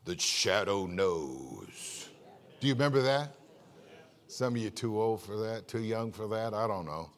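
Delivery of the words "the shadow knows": much lower, very slow, ominous radio narrator; spooky radio announcer, slowed right down; old radio Shadow line. Retold or imitated. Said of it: "we used to listen".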